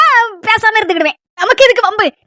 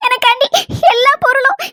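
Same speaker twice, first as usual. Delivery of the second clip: high, halting tearful voice; sobbing; 5 semitones higher than usual. Acted. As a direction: speak in short bursts broken by sobs. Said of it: "நீ முதல்ல அழுகை நிறுத்து". (விம்மி விம்மி அழுதுகொண்டே பேசுதல்)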